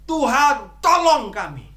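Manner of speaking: loud, high plea to the Lord; crying out for help. Retold or imitated. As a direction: call out to God as a desperate disciple. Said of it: "mereka teriak". (berseru keras memohon pertolongan)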